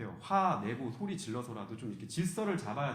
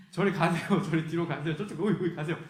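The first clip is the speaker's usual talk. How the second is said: loud, higher shout to push the crowd back; shooing people away; 4 semitones higher, 7 dB louder than usual. Retold or imitated. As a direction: bark them back out of the way. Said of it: "이렇게 해가지고". (사람들을 뒤로 밀어내는 제자, 높고 큰 소리로 쫓아내듯)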